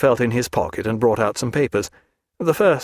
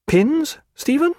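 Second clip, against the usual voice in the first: much higher, rising, amazed; astonished cry; slower than usual. A narrator's reading. Diana amazed at Stephen's gift, high astonished cry. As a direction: gasp out the question in disbelief. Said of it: "cried she, astonished".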